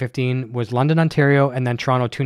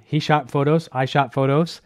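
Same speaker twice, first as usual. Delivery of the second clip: ordinary talk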